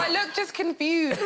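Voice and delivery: high-pitched, helpless laughing speech. Real, not acted. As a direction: talk through your own giggles. Laughing at own photo.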